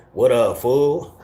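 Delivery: slow, stretched "What up, fool?" like a California homie; slow mock Cali hello; drawn-out Cali greeting. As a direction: draw it out lazily, Cali style. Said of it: "what a lot of the California fools say".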